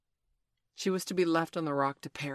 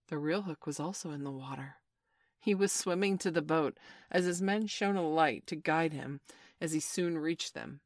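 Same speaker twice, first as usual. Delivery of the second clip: ordinary delivery, nothing marked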